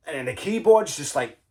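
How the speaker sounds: normal talking voice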